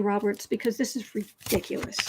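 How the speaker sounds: usual speaking voice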